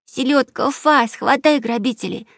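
high-pitched command to the dog; excited child calling out; crying out. Acted. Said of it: "закричала Эмилия".

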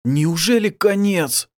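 high, slow, drawn-out question; disbelieving. A narrator's reading. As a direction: ask it in stunned disbelief. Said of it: "не поверил Басиль".

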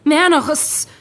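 heated protest. Acted. Streit um ihre Crew.

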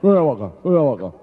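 loud, garbled grunting mayor; mayor eating a sandwich. Acted. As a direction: grunt the words out loudly round a mouthful. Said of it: "se estaba comiendo un bocadillo de chorizo".

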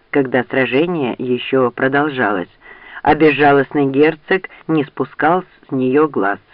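usual speaking voice

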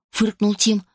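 usual speaking voice

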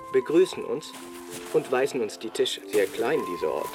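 usual speaking voice

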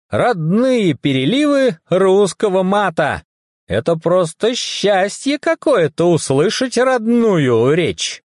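high, drawn-out mock-rapturous delight; gespielte Begeisterung. Figur freut sich über Muttersprache.

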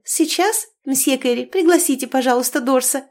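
put-on high, bright-eyed gushing like a naive girl; silly rapturous cry. A narrator's reading. Niece interrupting uncle, rapturous naive exclamation. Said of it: "восторженным голосом дурочки воскликнув".

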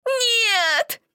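high tearful shriek; crying scream. Acted. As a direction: scream no through tears. Child screams refusal.

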